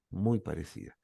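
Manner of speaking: "muy parecida" repeated softly, dropping away; quiet echo. Shown as a demonstration. Preacher echoing his point to himself, quietly. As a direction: let "muy parecida" fall to an undertone. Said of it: "La situación es muy parecida".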